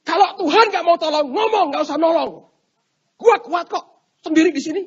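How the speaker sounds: high, loud and defiant; acted angry shout; enraged rant. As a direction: reject help in a huff. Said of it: "Ngamuk orang Kristennya".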